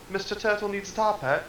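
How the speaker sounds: high, lightly Scottish turtle voice; a hint of a Scottish turtle; Scottish accent. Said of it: "His character's Scottish".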